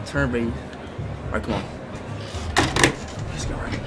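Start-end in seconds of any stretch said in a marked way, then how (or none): none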